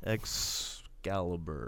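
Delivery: low, soft, drawn-out EXCALIBUR; slow wrong guess. Pretend. Host fumbling the comic title, slow and low. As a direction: trail slowly and quietly through the word. Botched try at a hard title.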